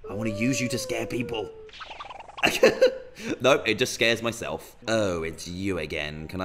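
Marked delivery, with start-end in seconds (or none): 1.8-3.4 airy, high laugh at yourself; breathless high laugh. Real, not acted. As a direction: wheeze with laughter. Scared by own snake.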